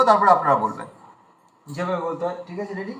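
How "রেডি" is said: ordinary talk, nothing marked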